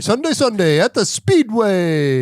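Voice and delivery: loud, slow Speedway ad announcer. Shown as a demonstration. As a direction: boom it out like a radio promo. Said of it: "I have to use your announcer voice".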